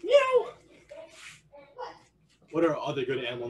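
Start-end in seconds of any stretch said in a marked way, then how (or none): 0.0-0.5 very high, sudden meow; cat meow. Shown as a demonstration. Coach calling animal sounds for stretch kicks, high meow. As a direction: yowl a quick high meow. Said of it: "only doing animal sounds".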